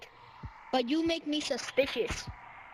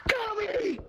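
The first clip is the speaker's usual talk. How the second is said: higher urgent call of a name; 6 semitones higher than usual. Real, not acted. Yelling for Tommy as he falls, urgent. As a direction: call across to save him. Calling friend in danger.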